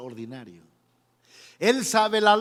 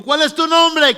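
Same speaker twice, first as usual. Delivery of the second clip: high, loud acted question; loud called question; 8 semitones higher, 9 dB louder than usual. Moses at the burning bush asking a name, loud high call.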